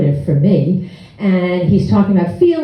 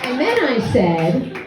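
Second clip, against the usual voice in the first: normal talking voice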